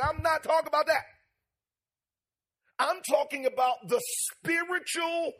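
loud preaching with rising cadence. Real, not acted. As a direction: preach it to the congregation. Preaching builds after the story.

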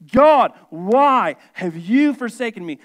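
loud, slow, emphatic plea; anguished cry to God. Acted. Preacher voicing Jesus' cry on the cross, loud plea. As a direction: cry it out word by word, as an appeal. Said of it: "Jesus is saying on the cross".

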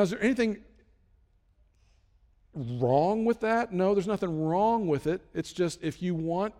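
normal talking voice

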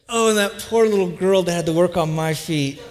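ordinary talk

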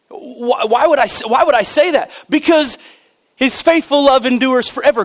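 emphatic pulpit proclamation; impassioned preaching. Real, not acted. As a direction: preach it out with rising fervor. Preaches with force.

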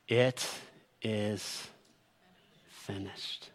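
low, halting, fading to almost nothing; hushed last words. Acted. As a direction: say it word by word, sinking away like a dying breath. Dying words of Jesus, voiced solemnly.

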